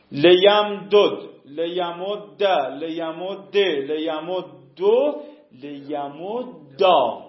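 each form of لیمد spelled out slowly; slow, deliberate teaching recital; slow paradigm recital. Shown as a demonstration. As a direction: recite the list slowly for the class. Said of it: "صیغهٔ دوم رو بسازم".

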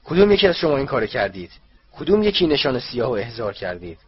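loud, furious interrogation; angry shout. A narrator's reading. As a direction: demand the culprit in a rage. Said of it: "او فریاد زد". (فریاد خشمگین)

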